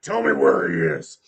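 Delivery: low, loud, menacing interrogator voice; captain uses his scary voice on the commander. Acted. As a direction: drop low and demand it loudly. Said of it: "he does the voice".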